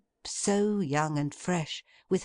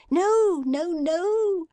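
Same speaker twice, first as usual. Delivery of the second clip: much higher, louder gleeful no-no-no; childlike glee; 7 dB louder, slower than usual. A narrator's reading. Meg stops her father's hand with childish glee. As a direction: squeal it like an excited child. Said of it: "with the glee of a child".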